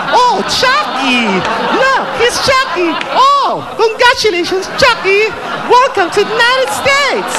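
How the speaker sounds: very high, slow, delighted gush; gushing consul. Retold or imitated. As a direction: gush over the star like a giddy fan. (kinikilig na konsul, napakataas at mabagal)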